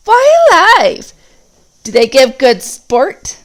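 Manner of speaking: very high, loud, slow goblin screech; a greedy goblin thrilled by the sport; eager orc. Acted. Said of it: "asked several voices".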